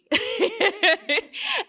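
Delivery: bright burst of laughter; high laugh. Real, not acted. Speaker laughing at her own joke to the audience, high burst of laughter.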